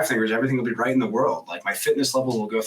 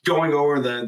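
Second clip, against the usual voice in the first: ordinary delivery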